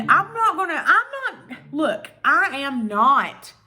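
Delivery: rising exasperation, slowing on the last words; ranting. Real, not acted. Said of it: "now that I got through that rant".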